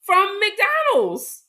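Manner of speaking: McDonald's drawn out high and loud; stress on McDonald's. Real, not acted. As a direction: stretch McDonald's proudly. Emphatic answer.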